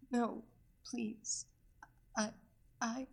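soft, breathy whimpering plea; a helpless captive whimpers no; whimpered. A narrator's reading. Captive begging her captor, whimpering softly. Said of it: "she just whimpered".

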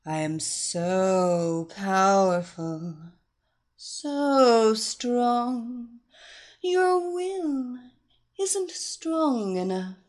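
slow, insistent hypnotic commands; commanding hypnotist voice. Acted. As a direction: press the commands slowly home.

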